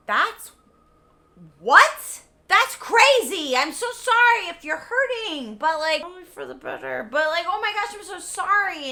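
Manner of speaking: loud, high startled "what", then gushing; mock shock turning to fake sympathy; hammed-up surprised boyfriend; shocked Seymour. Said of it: "And Seymour's like".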